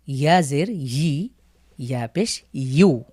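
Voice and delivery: ইয়া জের ই, ইয়া পেশ ইউ said slowly, ইউ like English you; slow Arabic letter drill. Shown as a demonstration. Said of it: "লক্ষ্য করুন". (ধীরে ধীরে ইয়া পেশ ইউ, ইউ ইংরেজির মতো)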